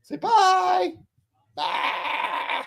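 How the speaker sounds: put-on very high cooing baby voice; baby talk. Father coaxing his toddler to wave bye, high cooing baby talk. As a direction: coax the toddler in a tiny squeaky voice.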